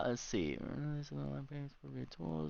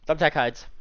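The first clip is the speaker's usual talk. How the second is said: normal talking voice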